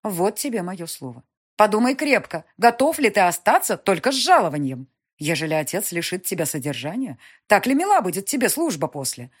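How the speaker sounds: властная графиня, громче и выше; мать поучает сына, с напором; напористая мать. Acted. Mother voiced. (властная мать, повышенным тоном)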